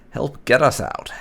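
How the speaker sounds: ordinary talk, nothing marked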